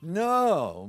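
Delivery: high, loud burst of "No"; a sudden loud protesting no; startled denial. Real, not acted. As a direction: jump in to brush off the compliment. Laughs off the sex symbol remark.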